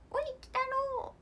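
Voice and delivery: put-on high squeaky old eyeball-father voice; Medama-oyaji impression. Idol imitating Medama-oyaji calling Kitaro, high squeaky voice. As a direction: pipe up high like the tiny eyeball dad calling his son. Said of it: "あ、これは目玉".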